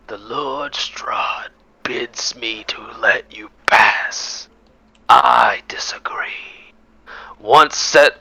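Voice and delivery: slow whispered gravelly wolf. Acted. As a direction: force the words out slowly, like a beast. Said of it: "in a gravelly, disjointed voice".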